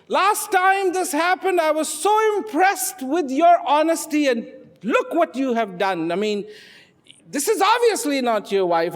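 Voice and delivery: high, loud, indignant scolding; upset God. Acted. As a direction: scold him in hurt disbelief. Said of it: "God was very upset".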